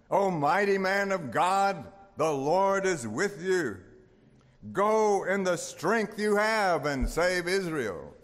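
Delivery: high, loud, slow proclamation; proclaiming angel. Retold or imitated. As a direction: call it out like an angel from heaven. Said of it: "The angel of the Lord appears to him and says".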